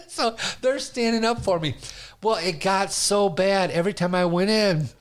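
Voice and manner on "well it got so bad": usual speaking voice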